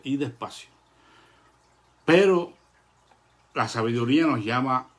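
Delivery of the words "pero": emphatic pero. Real, not acted. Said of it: "emphatic turn".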